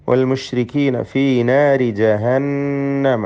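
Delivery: slow, with a long hummed nn in ജഹന്നമ; nasal doubled noon. Shown as a demonstration. Teacher shows students the noon rule, ജഹന്നമ hummed. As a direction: hold and hum the nn of ജഹന്നമ. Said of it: "നൂൻ മുഷദ്ദദയെ മണിക്കണം".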